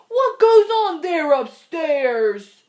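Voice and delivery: loud, slow moose call; a puzzled moose calling upward. Acted. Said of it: "he called out".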